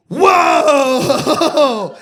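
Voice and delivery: loud startled whoa; surprised whoa. Real, not acted. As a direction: react as if shocked. Exclaiming about Grindr.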